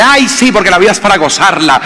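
put-on carefree girl's reply. Girlfriend voiced in the story.

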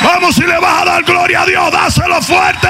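impassioned pulpit shout; fiery preaching. Real, not acted. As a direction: preach it at full force to the congregation. Preaching at full force.

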